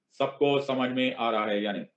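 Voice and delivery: usual speaking voice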